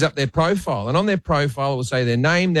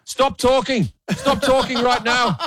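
higher, urgent mock producer in the earpiece; panicked producer; 10 semitones higher, slower than usual. Producer in the earpiece begging the host to stop, higher and urgent. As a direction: hurry him off the topic like a nervous producer. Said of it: "I hear in my earpiece like this".

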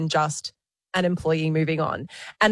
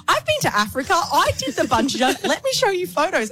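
higher, eager show-off voice; imitating Keisha; 10 semitones higher than usual. Host imitating Keisha interrupting the TV, eager and higher. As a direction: mimic a friend bursting with travel news. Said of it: "And then Keisha goes".

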